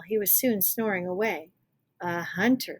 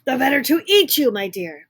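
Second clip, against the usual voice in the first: very loud, higher pounce; wolf lunging at the girl, loud; cried out; 5 semitones higher, 11 dB louder than usual. Acted. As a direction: roar it as he pounces. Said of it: "cried the wolf".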